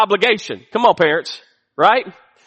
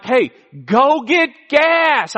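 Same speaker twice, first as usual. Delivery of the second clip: slow, emphatic order, pitched higher; exasperated parent; 4 semitones higher, slower than usual. Acted. Dad telling his teenager about the empty tank, exasperated and drawn out. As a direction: draw out each word of the command.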